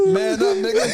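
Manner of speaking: high-pitched cackling through the words; laughing hard. Real, not acted. Host telling heartbroken caller to toughen up, laughing hard. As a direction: crack up while talking, voice shooting up.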